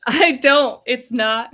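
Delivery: loud, high protest; alarmed denial. Acted. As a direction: deny it loudly, pitch jumping up. Acted denial when accused in story.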